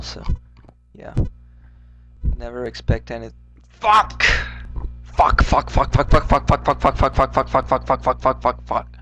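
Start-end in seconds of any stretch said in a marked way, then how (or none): 3.7-4.2 sharp high yell of frustration; angry outburst. Real, not acted. Sudden fail in game. 5.0-9.0 rapid string of curses, fading out; frustrated swearing. Real, not acted. Repeated swearing after failing.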